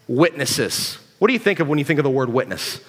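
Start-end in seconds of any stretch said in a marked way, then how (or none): 0.1-1.0 slow scripture reading. Shown as a demonstration. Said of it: "Look down in verse eight".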